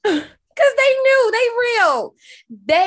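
high, loud laughing squeal; laughing cry. Real, not acted. Cohost laughs hard at the pizza gift.

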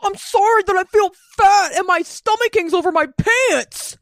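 mock dramatic confession. Imitating the comic's overdramatic confession to Miranda, hammed-up wail. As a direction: lay on the melodrama like a soap star. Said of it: "she rolls over so dramatically".

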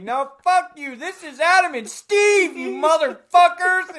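high, loud defiant shout; retold yelling. Kicked out of the store, shouting back at the staff. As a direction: yell it at the staff as you're thrown out. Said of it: "you start yelling at people".

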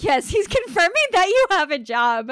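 giggling as you parrot the psychic; laughing mock quote. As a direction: mimic her while cracking up. Mocking the medium's claim.

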